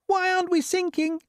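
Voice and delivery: very high-pitched character voice; worried passenger. A narrator's reading. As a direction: ask it in a squeaky, puzzled voice. Passengers argue aboard the falling peach.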